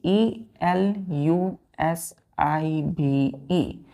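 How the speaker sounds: letters spelled slowly; teacher spelling elusive; spelling aloud. Shown as a demonstration. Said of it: "elusive".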